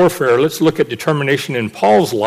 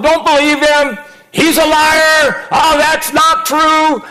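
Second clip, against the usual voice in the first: mocking heckler; 13 semitones higher, 3 dB louder, slower than usual. Heckler yelling during sermon.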